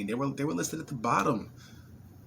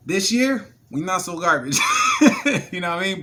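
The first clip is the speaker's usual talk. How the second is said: excited; 7 semitones higher, 9 dB louder than usual. Real, not acted. Excited about team's March Madness win.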